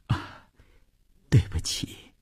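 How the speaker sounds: soft, hushed, faltering apology; barely voiced sorry; faint apology. A narrator's reading. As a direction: apologise in a small, weak voice. Wife apologises weakly to husband.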